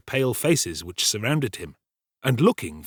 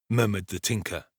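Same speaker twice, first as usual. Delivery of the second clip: plain reading voice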